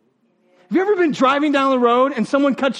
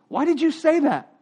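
questioning parent; 6 semitones higher than usual. Retold or imitated. Parent questioning child in acted exchange.

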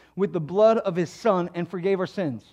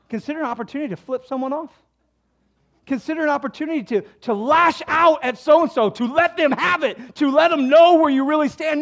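louder and higher, building into a mock tirade; hammed-up indignant outburst; mock angry rant; 9 semitones higher, 8 dB louder than usual. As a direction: lash out in sarcastic mock fury. Mock complaining reading of scripture.

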